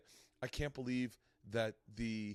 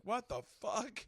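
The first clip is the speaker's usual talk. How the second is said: high, slow, drawn-out disbelief; incredulous; 9 semitones higher, 3 dB quieter, much slower than usual. Retold or imitated. Mocking a comic's success, high drawn-out disbelief. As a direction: stretch it out in shock. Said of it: "where you're just like".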